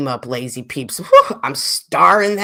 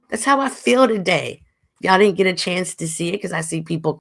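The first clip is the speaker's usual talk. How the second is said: ordinary delivery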